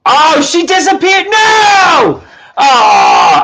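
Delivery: high, loud shocked cry; dismayed shouting. Real, not acted. Host crying out as a guest vanishes, high shout. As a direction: yelp as she disappears. Viewer vanished mid-stream.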